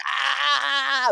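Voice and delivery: shrill cry of laughter; high laughing scream. Retold or imitated. Screaming with laughter, grabbing friends.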